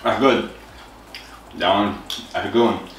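chewing while talking; muffled through food; mouth full of cereal. Real, not acted. Seen 3: Holds cereal bowl after spoonfuls; lips closed, cheeks working between words.

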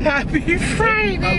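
high, slow, lilting happy Friday; playful drawn-out greeting; sing-song echo. Pretend. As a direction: stretch it out in a cheery singsong. Echoing friend's cheerful sign-off.

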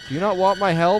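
loud offended question; exasperated repeat. Real, not acted. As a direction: repeat it fed up, pushing harder.

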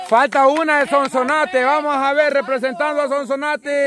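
high-pitched announcer-like rallying call. Real, not acted. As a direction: call out to fire up the team. Rallying the Sonsonate team.

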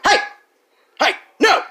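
sharp high shout like scolding a dog; loud scream. Shown as a demonstration. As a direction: bark it out to make them back off. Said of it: "just scream at 'em real loud".

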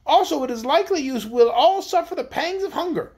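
high-pitched queen addressing her officers; imperious queen. A narrator's reading. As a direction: press them in a high, haughty royal voice.

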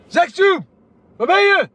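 calling out. Real, not acted. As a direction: shout out as if searching for someone. Calling out for Zextube.

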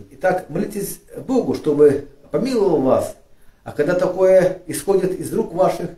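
usual speaking voice

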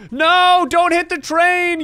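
high, loud cry, slow on the warning; panicked shout. Real, not acted. Gamer watching boat near train, panicked shout.

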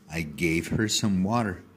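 usual speaking voice

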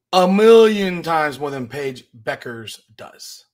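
MILLION stretched out loud and slow; emphatic boast. Real, not acted. Commentator claims to outdo Paige Bueckers, emphatic and drawn out. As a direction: lean hard and long on "million", slow delivery.

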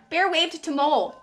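ordinary delivery, nothing marked

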